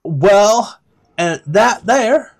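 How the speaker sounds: a chirpy guide pointing things out; high NPC voice. Acted. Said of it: "grabs the book once more and goes".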